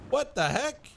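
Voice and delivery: high startled what the heck; real startled exclamation; surprised. Real, not acted. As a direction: react in sudden surprise. Surprised reaction.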